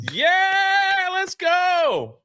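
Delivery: high, hammed-up crowd cheer. Retelling the crowd greeting the heroes, hammed-up cheer. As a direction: cheer like a fan at the homecoming.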